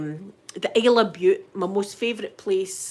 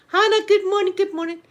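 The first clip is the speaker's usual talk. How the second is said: excited greeting; 10 semitones higher, 4 dB louder than usual. Real, not acted. Greeting a viewer.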